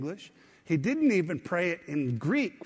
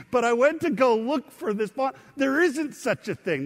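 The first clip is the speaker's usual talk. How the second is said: excited; 7 semitones higher, 5 dB louder than usual. Real, not acted. Excited about his new font.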